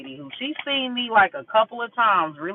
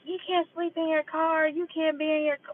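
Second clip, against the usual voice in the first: high, sing-along mocking imitation; mock her telling you off in a raised pitch; higher put-on nagging voice; mimicked scolding lady; 9 semitones higher, 4 dB quieter than usual. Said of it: "she went off on me".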